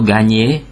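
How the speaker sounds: gagner with gn said as "ny". Shown as a demonstration. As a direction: say gagner like "ga-nyay". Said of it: "G N betűcsoportot enynek ejtjük".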